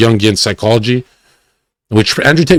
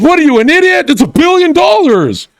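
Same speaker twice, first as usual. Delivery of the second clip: mock incredulous Tate; 13 semitones higher than usual. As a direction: act shocked anyone would refuse the money. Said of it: "And he goes".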